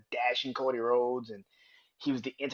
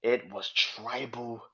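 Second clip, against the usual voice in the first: ordinary talk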